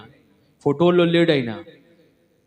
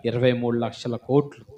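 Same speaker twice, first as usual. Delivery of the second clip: usual speaking voice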